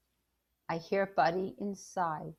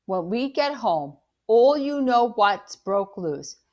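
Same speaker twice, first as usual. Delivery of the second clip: plain reading voice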